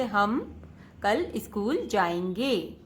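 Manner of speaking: "हम कल स्कूल जाएंगे" read slowly as one smooth word group; phrase reading. Shown as a demonstration. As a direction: read it in a single unbroken phrase. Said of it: "शब्दों के समूह के साथ पढ़ना है". (शब्दों के समूह में धीमा, सहज पठन)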